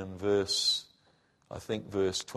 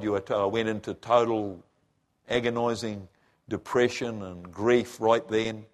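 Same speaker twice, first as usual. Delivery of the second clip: normal talking voice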